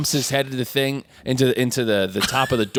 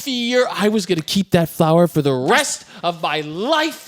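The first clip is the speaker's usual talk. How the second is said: higher, hammed-up indignant tirade; imitated angry scolding; mock outrage; 4 semitones higher than usual. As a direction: act out a wronged girl's fury. Acting out Sarah berating the beast.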